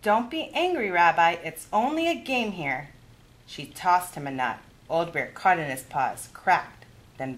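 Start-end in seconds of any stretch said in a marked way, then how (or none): 0.0-2.9 a higher, lively grandmother voice; kindly old granny offering a game; Baba Bryna's voice. Acted. Baba soothes bear.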